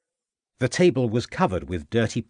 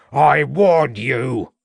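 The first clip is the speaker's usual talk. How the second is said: slow, loud, higher furious shout; 4 semitones higher, 6 dB louder, much slower than usual. A narrator's reading. Farmer shouting as he sets the dog loose. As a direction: roar the warning as he lets go. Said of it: "shouted Troutbridge".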